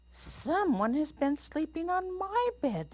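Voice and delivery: very high mama bear. A narrator's reading. Mama Bear finds her bed slept in, high voice. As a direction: pitch it way up, airy and startled. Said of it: "said Mama Bear".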